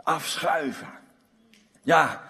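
ordinary delivery

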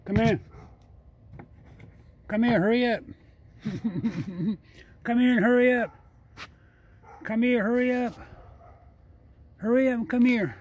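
high, coaxing call to an animal. Real, not acted. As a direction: call the raccoon over, high and bright. Calling a raccoon over.

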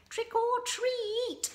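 high, loud, drawn-out doorstep call; shrill singsong mockery of trick-or-treaters; mimicking trick-or-treaters. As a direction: imitate kids chanting at the door, stretching every word. Mimics children calling at doors.

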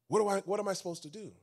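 ordinary talk

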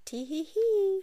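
high, tiny tee-hee giggle; tiny unseen giggle from behind the books; faint tee-hee giggle. Acted. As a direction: giggle like a hidden imp. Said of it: "a faint".

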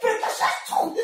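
high, strained squeal of protest; mock outrage. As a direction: protest in high-pitched disbelief. Scolding the hand after slap.

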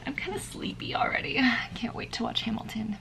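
soft, sleepy whispered aside; drowsy whisper before a show. Real, not acted. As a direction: whisper it like it's late and you're drowsy. Sleepy late-night whisper.

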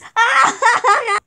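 gloating laugh. Acted. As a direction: laugh at the losers. Gloating laugh after licorice.

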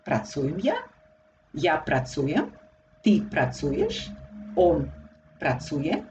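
rising tune at the end of each "pracuję ja", "ja pracuję"; question intonation. Shown as a demonstration. Teacher showing Slovak questions formed by intonation, question intonation. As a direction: lift the last word of each phrase into a question. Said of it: "formed by intonation".

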